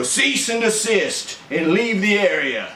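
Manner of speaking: military father order. Retold or imitated. Father dismissing his son while eating alone, slow mock command. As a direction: clear the area like an officer on duty. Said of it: "he'd look at me and go".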